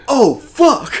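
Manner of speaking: high, cracking "oh fuck" in sudden panic; panicked yelp. Retold or imitated. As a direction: realize you've done it and flinch. Retold panic after hitting car.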